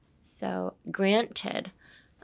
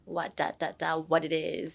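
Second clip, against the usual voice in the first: 'what da da da' and 'what it is' in one low, even tune; match 'what it is' to 'what da da da'; same intonation; 3 semitones lower, 7 dB quieter than usual. Shown as a demonstration. Teacher comparing with Kepler's song, matched rhythm on 'what it is'. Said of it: "has the same intonation".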